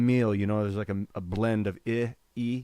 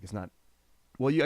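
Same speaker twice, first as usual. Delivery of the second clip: ordinary delivery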